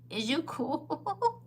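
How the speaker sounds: mock disbelief, stretched and rising; mock incredulous. Woman retelling her comeback to a lying suitor, mock incredulous.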